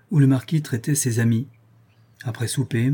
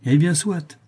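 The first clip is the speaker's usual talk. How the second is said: higher, airy concession hiding pique; feigned unconcern; 6 semitones higher, 4 dB louder, slower than usual. A narrator's reading. Marquis hiding his vexation from friends, airily unconcerned. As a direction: concede it lightly, masking annoyance. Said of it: "d'un air dégagé qui cachait son dépit".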